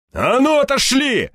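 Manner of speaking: high, forceful shouted order; barked command. A narrator's reading. Hero waving a torch at thugs, barking. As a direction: bark it out to drive them back. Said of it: "рявкнул я".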